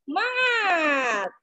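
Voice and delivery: high, drawn-out rallying cheer; cheerleader count-in. Real, not acted. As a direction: count them in, then stretch the cheer long. Group cheer led by instructor.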